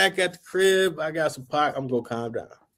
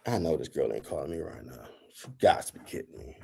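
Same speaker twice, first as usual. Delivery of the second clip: much softer, half-whispered disbelief; disbelieving mutter; 11 semitones lower, 11 dB quieter than usual. Retold or imitated. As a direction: mutter it to yourself, can't believe it. Disbelief at her calling.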